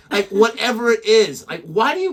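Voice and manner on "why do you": usual speaking voice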